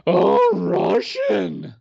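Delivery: high, drawn-out mock gasp; mock outrage. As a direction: gasp it out like a scandalized reporter. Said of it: "the Western media is gonna jump on and be like".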